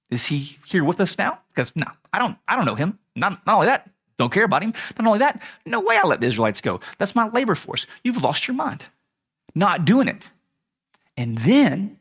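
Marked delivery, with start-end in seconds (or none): 0.1-10.3 high-pitched, incredulous mock refusal; scornful refusal. Pharaoh refusing to let his labor force go, scornful refusal. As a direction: dismiss it with comic disbelief.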